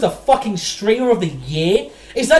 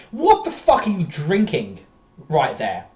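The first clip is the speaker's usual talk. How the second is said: usual speaking voice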